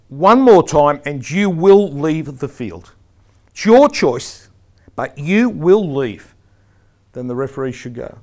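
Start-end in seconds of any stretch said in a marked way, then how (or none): 0.1-6.3 loud, slow, forceful ultimatum; emphatic final warning. Shown as a demonstration. Referee warning a yellow-carded player, emphatic and firm. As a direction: hammer it home to the player, unhurried and loud. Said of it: "put the emphasis on who was responsible".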